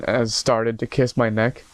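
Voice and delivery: usual speaking voice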